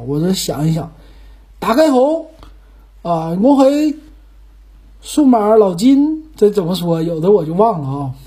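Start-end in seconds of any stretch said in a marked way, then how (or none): 1.6-6.3 high, halting Cantonese show greeting; attempted Cantonese. Shown as a demonstration. Host thinking up an opening, halting Cantonese. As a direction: piece the greeting together in amateur Cantonese. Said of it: "再整两句词儿哈".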